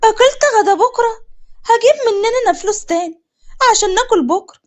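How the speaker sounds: aghast that tomorrow's lunch is gone; shocked. Acted. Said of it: "سوكا بصدمة". (صدمة بصوت عالي ومرتفع)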